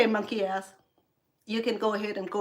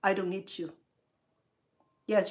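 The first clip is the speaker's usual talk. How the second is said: ordinary talk, nothing marked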